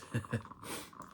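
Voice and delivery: short real laugh through tears; tearful laugh. Real, not acted. Tarot reader to viewers about crying, tearful laugh. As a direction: laugh off your own crying. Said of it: "I don't know why I'm crying".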